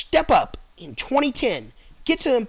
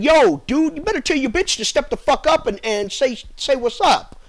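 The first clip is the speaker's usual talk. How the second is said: louder, higher street-tough demand; angry people confronting the president, louder and higher; mock angry; 6 semitones higher, 4 dB louder than usual. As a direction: fire it off like an angry crowd. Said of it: "we got mad and said".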